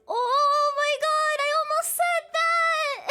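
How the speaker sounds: slow, loud, high panicked yell; panicked shout. Retold or imitated. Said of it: "I was like".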